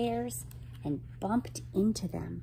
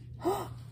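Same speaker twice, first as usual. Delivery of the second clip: high, quiet intake of breath; alarmed gasp; 13 semitones higher, 5 dB quieter than usual. A narrator's reading. Waiter has caught up with the children, alarmed gasp. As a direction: gasp in suspense.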